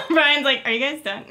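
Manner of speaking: giggling through the words; laughing. Real, not acted. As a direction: laugh while saying it. Laughing while quoting.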